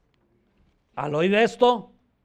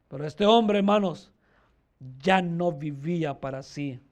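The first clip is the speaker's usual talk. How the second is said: ordinary talk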